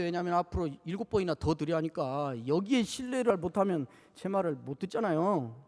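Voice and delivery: ordinary delivery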